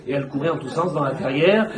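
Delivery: usual speaking voice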